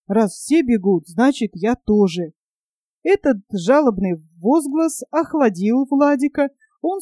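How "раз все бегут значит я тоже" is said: usual speaking voice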